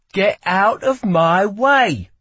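slow, loud, high command; forceful demand. A narrator's reading. Lila with knife drawn to the guard, forceful demand. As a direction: press every word on him. Intruder orders guard aside.

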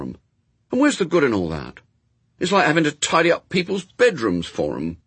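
put-on high, loud, indignant boy; Adam, protesting boy. A narrator's reading. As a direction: argue like a child who finds it all unfair.

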